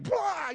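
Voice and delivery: very high yelled blah; mock outburst. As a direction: burst out like a touched nerve. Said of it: "And you're like".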